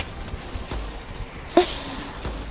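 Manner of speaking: high, soft giggle; real suppressed laughter; stifled laugh. Real, not acted. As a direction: break into a quiet laugh you tried to hold in. Can't even keep a straight face.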